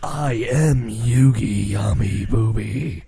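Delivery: low, slow, grandiose hero voice; mock Yami Yugi. Mocking Yugi from the dub, deep drawn-out voice. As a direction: intone it deep and pompous. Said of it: "Yugi's all like".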